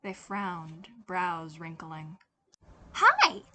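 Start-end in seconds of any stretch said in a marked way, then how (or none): 3.0-3.4 put-on high, loud childish chirp; bright chirp. Boy greeting the new cashier, loud bright chirp. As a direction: chirp it like an eager little kid. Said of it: "Tim chirped brightly".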